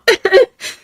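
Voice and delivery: high, loud laugh through tears; tearful laugh. Real, not acted. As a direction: laugh while still crying. Touched by chat message.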